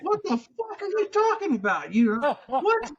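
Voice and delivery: high, disbelieving cry with a laugh; half-laughing mock rage at the phone; incredulous outburst. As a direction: blurt it out, baffled and laughing. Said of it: "And I'm like".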